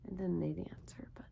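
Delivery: hushed, voiceless aside; whispered aside. Real, not acted. A teacher whispers to herself while waiting for the children. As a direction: whisper it to yourself.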